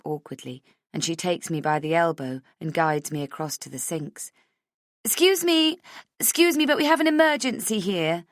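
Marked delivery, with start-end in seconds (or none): 5.0-8.2 loud urgent excuse me. Acted. Friend pushing through to mirror.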